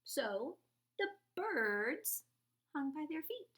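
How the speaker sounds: ordinary delivery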